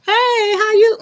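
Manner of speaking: very high, long-held hey; gushing hugger's greeting. Retold or imitated. Woman walking up to hug people, high stretched greeting.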